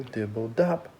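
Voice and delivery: ordinary delivery, nothing marked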